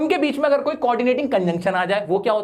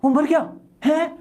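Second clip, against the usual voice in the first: sad shock; 6 semitones higher than usual. Shown as a demonstration. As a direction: ask it as if hearing someone has died. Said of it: "और एक होती है हम बहुत उदास होते हैं". (दुख भरी हैरानी, धीमी और सहमी आवाज़ में)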